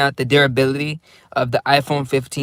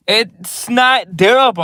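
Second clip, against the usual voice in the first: higher, louder, slow it's not durable; slow stressed warning on durable; emphatic claim; 7 semitones higher, 6 dB louder, slower than usual. Real, not acted. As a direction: hammer the point home. Emphatic complaint.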